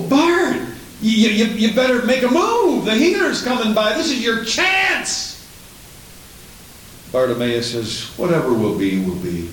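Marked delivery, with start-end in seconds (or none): 0.0-5.4 high-pitched, excited nudge to a friend; urgent urging. Acted. Voices someone urging Bartimaeus to act. 8.2-9.5 low, sinking shrug of a line; resigned fatalist. Acted. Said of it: "didn't act like a fatalist".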